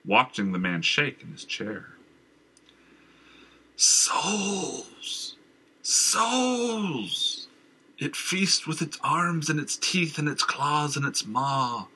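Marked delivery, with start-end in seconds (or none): 3.8-11.9 high frantic raving cry; shrieking madman. Acted. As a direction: wail it like a madman. Madman shrieks about the monster.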